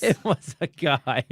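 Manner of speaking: slow, loud, laughing words; real amused laughter. Real, not acted. Host laughing at his co-host's question, amused and drawn out. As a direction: laugh out the line, drawing it out.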